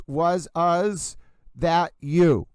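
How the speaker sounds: "was" echoed as "was, us", very slow; echoed ending. Shown as a demonstration. Host imitating the echo, drawn-out "was us". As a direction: double the end of WAS, stretch "that you". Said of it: "the A S echoes".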